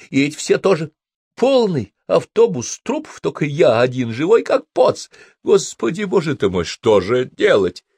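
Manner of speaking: high, frantic panic; panicked. Acted. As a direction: gasp it out in horror. Panicked monologue over dead passengers.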